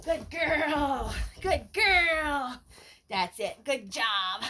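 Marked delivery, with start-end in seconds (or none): 0.0-2.6 praise. Real, not acted. Praising puppy.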